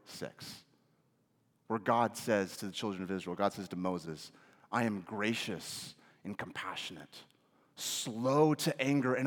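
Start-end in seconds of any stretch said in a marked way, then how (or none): 4.7-9.3 much softer and lower; tender quote. Real, not acted. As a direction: speak it as a gentle promise. Quoting God to Moses.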